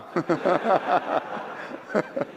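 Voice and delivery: real laugh. Real, not acted. Preacher laughs at the story.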